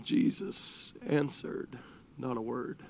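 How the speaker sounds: low, very soft, halting words; hushed narration. A narrator's reading. Preacher telling how Jesus stayed silent, hushed. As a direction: drop almost to nothing, pausing between phrases. Unanswered plea, hushed contrast.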